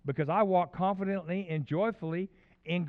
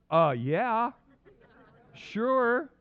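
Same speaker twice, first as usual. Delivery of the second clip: sarcastic yeah sure; 7 semitones higher, 6 dB louder, much slower than usual. Retold or imitated. Mocking a clerk's reply.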